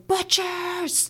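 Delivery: high, held-back imitation of yelling; distant-sounding mock scream. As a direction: yell it high without the volume. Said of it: "and she is just yelling".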